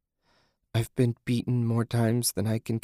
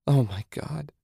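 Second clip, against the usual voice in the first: higher-pitched, mildly distraught exclamation; anguished cry; 5 semitones higher than usual. A narrator's reading. Susan appalled at Julie, crying out higher. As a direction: cry it out in dismay, without shouting. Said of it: "Susan cried".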